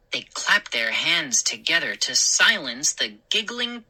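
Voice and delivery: ordinary delivery, nothing marked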